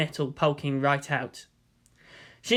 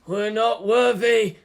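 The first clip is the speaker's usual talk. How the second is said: slow, slightly higher bowing chant; mock reverent; 5 semitones higher, 3 dB louder, slower than usual. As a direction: grovel in hammed-up awe. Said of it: "in between kowtows".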